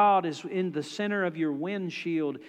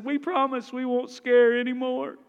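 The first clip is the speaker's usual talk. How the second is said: lightly voiced kids, higher and louder, begging; pleading little boys; 7 semitones higher, 4 dB louder than usual. Boys negotiating with dad, mock pleading. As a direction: plead fast like a desperate little boy. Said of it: "one of them piped up and said".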